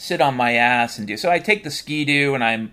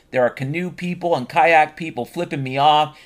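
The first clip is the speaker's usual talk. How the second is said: ordinary talk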